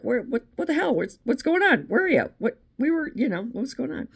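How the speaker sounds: high, mock-baffled dog voice; confused dog. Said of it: "the dog's looking around like".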